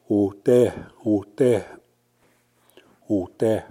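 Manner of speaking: ote with a clear final E; Cree word ote. Shown as a demonstration. Teacher models the southern word ote, clear demo. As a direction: say ote, o-TEH. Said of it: "which means over here".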